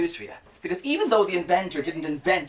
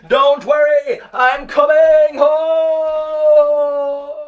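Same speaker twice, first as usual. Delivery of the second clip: loud, high, joyful call home; shouting; 8 semitones higher, 10 dB louder, much slower than usual. Acted. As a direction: yell it across the sea, long and stretched.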